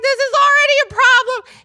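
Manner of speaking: alarmed shout. Retold or imitated. New teacher reacting to the screaming boy, loud high outburst. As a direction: blurt it out in panic. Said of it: "I was like".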